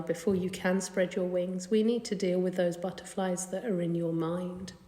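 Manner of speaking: ordinary talk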